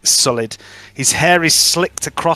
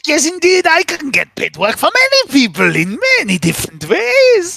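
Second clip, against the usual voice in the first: booming sing song welcome; 9 semitones higher than usual. Acted. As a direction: greet them like a grand showman. GM voices merchant NPC.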